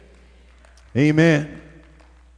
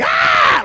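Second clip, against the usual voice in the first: exaggerated outraged yelp; mock teenage scream; 4 dB louder than usual. Daughter told to clean her room, mock-horrified scream. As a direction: cry out like a put-upon daughter. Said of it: "She's like".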